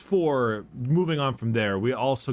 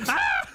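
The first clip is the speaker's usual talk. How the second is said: a sudden very high shriek; mock shriek. As the Emperor answering his son, a very high mock shriek. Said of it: "And he's like".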